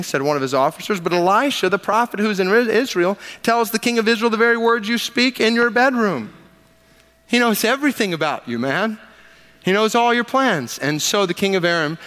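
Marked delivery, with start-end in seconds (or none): 1.0-10.8 urgent report. Acted. Officer reports to the king.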